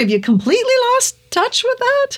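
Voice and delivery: squealing incredulous questions through a laugh; laughing disbelief. Retold or imitated. Mocking angry viewers over accent.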